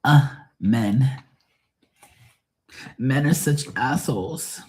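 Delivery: high, drawn-out mock huff; lightly hammed-up exasperated woman; mock exasperation. Imitating a viewer complaining about men, mock exasperation.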